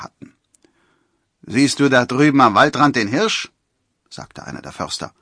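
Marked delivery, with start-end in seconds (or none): 1.5-3.5 higher, loud forester's voice; taunting challenge. A narrator's reading. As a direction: challenge him loudly.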